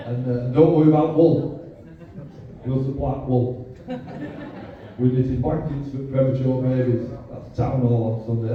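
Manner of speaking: a hint of a broad cartoon Yorkshire radio advert, half laughing; mock radio advert in a cartoon Yorkshire voice. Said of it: "that cartoon Yorkshire voice filled the air".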